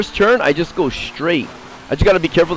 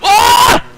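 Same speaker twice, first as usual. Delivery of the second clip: sudden high yelp of alarm; real panic yelp as the kart slips; startled shout; 6 dB louder than usual. Real, not acted. Racer yelping as the kart veers off, startled shout.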